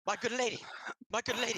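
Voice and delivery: high, loud panicked cry; frantic stablehand. Acted. Stable boy crying out to the lady in a panic. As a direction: burst in shouting in alarm.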